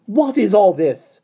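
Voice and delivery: high, loud, slow demand; nanny breaking up a fight sternly. A narrator's reading. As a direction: stop the fight cold. Mary Poppins interrupts.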